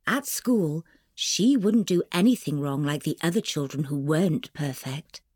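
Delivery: ordinary delivery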